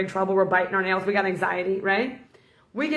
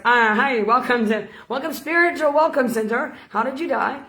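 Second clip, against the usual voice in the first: high, loud bright-eyed greeting; chirpy receptionist; 3 semitones higher, 4 dB louder than usual. Retold or imitated. Welcome lady greets the newly dead murderer, chirpy and loud. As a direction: gush like a cheery front-desk lady.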